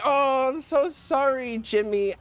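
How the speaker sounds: high, slow, gushing apology; mock apologetic mommy. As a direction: coo it high like a guilty mother. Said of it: "mommy feels bad".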